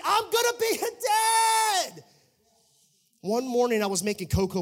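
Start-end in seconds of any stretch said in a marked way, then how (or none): 0.0-2.0 high, drawn-out shout of joy; exultant announcement. Retold or imitated. New father telling everybody, exultant shout. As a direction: belt it out to the whole world. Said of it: "I was telling everybody".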